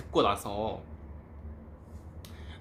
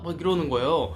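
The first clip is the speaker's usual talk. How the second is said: ordinary talk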